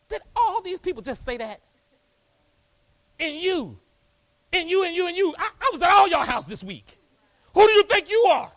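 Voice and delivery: rising to a high, loud rebuke; Jesus scolding the crowd. Acted. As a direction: call them out one by one, building to a shout.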